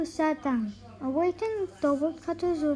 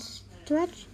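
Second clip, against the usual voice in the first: usual speaking voice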